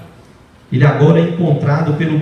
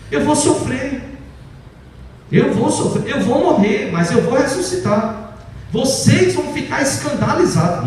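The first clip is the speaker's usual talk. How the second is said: Jesus's solemn warning, pitched higher; voicing Jesus; 7 semitones higher than usual. Jesus warning the apostles he will suffer and rise. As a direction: warn the disciples gravely. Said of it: "ele estava advertindo os apóstolos".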